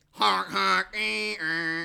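loud clown horn honks; silly clown. Pretend. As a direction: honk like a goofy clown horn. Silly radio sound effects.

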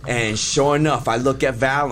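ordinary talk

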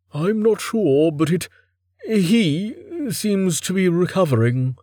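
high-pitched, unsure character voice; hesitant. Acted. Merrick reports on the recovering goat, hesitantly. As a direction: feel your way through it, pitched high.

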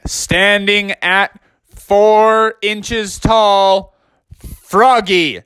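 drawn-out ring announcer intro; big, slow boxing introduction, last name held long. Acted. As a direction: stretch the name out like a fight announcer.

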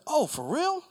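high-pitched surprised reaction; imitating an impressed stranger; mock astonishment. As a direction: squeal it in exaggerated surprise. Mimics man's astonished reply.

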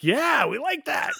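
high, hammed-up producer enthusiasm; imitating over-keen producers; mock eagerness. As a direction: jump on the idea in a high excited yell. Producers eagerly approve a joke.

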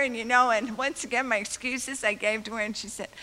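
ordinary talk

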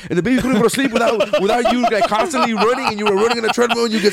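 high, fast, gushing fan; geeking out. Retold or imitated. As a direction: rattle it off like an eager superfan. Fan geeking out at Paul Reiser.

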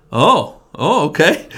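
replaying the shock of being asked, high and loud; startled okay. Retelling his reaction to the pastor's request, startled and high. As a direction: caught off guard, voice jumps high and loud.

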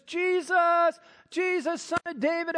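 high, loud imitated cry from a crowd; calling out. Voice in the crowd calls to Jesus, acted shout. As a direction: call out over a noisy crowd. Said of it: "this cacophony of voices".

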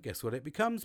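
ordinary delivery

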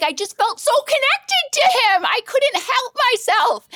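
high, gushing wail; real overwrought confession. Real, not acted. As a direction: plead it high, stretching the last words. Defending the embarrassing kiss.

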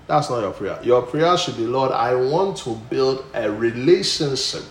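loud, soaring preacher's voice; full-force preaching. Acted. Pastor thunders to the church about Paul, loud preaching. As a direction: preach it out to the congregation.

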